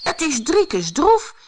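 high, urgent storybook cry; excited high-pitched alert; alarmed exclamation. A narrator's reading. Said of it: "riep Brammetje Braam".

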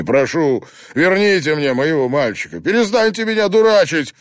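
high, loud, breaking plea; desperate pleading. A narrator's reading. Father kneeling before the priest, begging desperately for his son. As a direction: beg on your knees for your boy.

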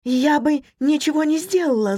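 high-pitched, faltering denial; stammering. A narrator's reading. Wife denies to her husband she meant harm, stammering. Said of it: "запинаясь, пробормотала его жена".

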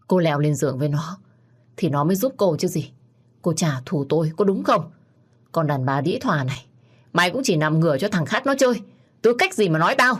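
put-on a drunk man hurling insults at her against the wall; furious drunk insults. (chửi rủa giận dữ, to dần rồi hét cao)

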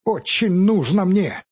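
high, loud, insistent; angry accusation. Acted. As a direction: press the accusation hard. Quarrel continues.

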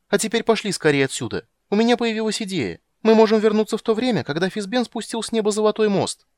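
high, loud hurried urging; eager. Acted. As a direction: pull him along excitedly. Kender urges leaving, new idea. (нетерпеливо, возбуждённо)

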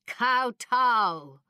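high, drawn-out formal command. A narrator's reading. Secretary ordering the standing doctor down, commanding. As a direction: order it like a court official. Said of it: "the secretary commanded".

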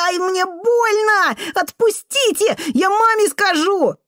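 put-on very high, slow crying child's voice; pained wail. A narrator's reading. Boy with his hair pulled by the janitor, wailing in pain. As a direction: wail and threaten through tears.

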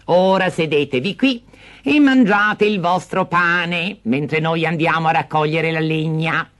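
high, slow falsely calm stepmother; scheming stepmother. Acted. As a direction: settle them down to leave them. Stepmother deceives.

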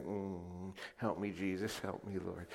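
a hushed plea for help; quiet prayer. Retold or imitated. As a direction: pray softly to yourself so nobody hears. Praying quietly while mocked.

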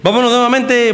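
high, loud rallying call; excited relaunch. Real, not acted. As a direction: pump up the audience again. Host relaunching the music.

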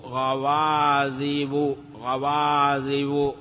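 very slow "غوازب" with held vowels; slow, deliberate modelling of the word; drawn-out citation. Shown as a demonstration. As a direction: stretch "غوازب" out syllable by syllable. Repeats the form slowly, then normally.

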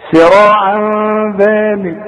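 slow chanted recitation with nasal tanween; tajweed recitation. Shown as a demonstration. Teacher demonstrating tanween ikhfa, slow melodic recitation. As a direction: recite سراعا ذلك slowly, hiding the tanween into the ذال with ghunna. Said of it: "شوف".

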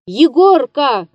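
loud calling out into the dark; shouted name. A narrator's reading. Old actor calling into the dark theatre, shouting. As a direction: call out loud into the empty theatre. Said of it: "крикнул он".

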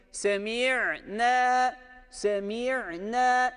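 semi'ne with a clear ayn break; correct with ayn. Shown as a demonstration. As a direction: show the ayn catch in semi'ne. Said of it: "Bu doğru okuyuştur". (semi'ne'de ayın harfini belirgin oku)

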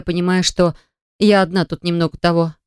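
ordinary delivery, nothing marked

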